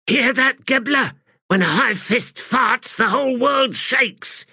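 a rowdy soldier cracking a joke; jeering soldier. Acted. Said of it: "a soldier's voice hissed".

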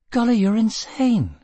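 high, disbelieving cry; shocked disbelief; incredulous. A narrator's reading. Gisbella aghast.

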